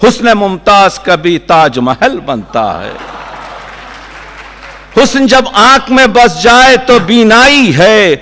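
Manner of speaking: high ringing mushaira cadence, gradually easing down; poetry recitation. Acted. Poet repeating verses on beauty to applause, declaiming. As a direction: declaim each line with a rhythmic lift.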